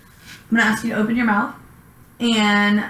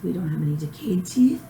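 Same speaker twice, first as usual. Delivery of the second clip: whispering; 4 semitones lower, 3 dB quieter than usual. Real, not acted. Aside during oral exam.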